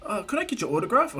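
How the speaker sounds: put-on high, boyish asking voice; shy fanboy. Boy asking his idol for an autograph, high and hopeful. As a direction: ask hopefully, pitched well up.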